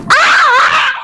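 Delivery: high, sudden scream; startled scream. Real, not acted. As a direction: scream as the attack hits you. Startled by knife in game.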